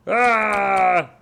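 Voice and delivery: loud, high zombie shriek; zombie roar. Pretend. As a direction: lunge with a zombie yell. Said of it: "pretend I'm a zombie".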